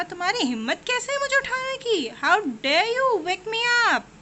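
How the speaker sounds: high-pitched lion fury; a roaring lion's outrage; angry lion. Acted. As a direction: demand it like an insulted king. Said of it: "कितना गुस्से में है ना lion".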